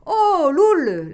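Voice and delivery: loud, high hail across the water; calling out. A narrator's reading. Warden hailing Loul from his boat, loud call. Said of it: "l'interpella alors le gardien".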